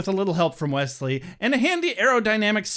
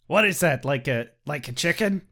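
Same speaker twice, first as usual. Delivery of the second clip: ordinary talk, nothing marked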